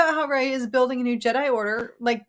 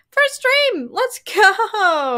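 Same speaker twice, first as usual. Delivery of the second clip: high, loud, drawn-out cheer; excited cheer; 9 semitones higher, 5 dB louder, much slower than usual. Real, not acted. Welcoming a first-time viewer, excited cheer. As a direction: celebrate it big and high.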